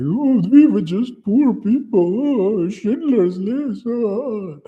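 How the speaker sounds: very high, slow, drawn-out mock lament; mock victim wail. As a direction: wail theatrically in a put-on falsetto. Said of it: "it really destroys the narrative".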